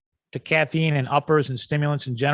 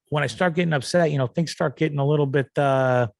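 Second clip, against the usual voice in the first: ordinary talk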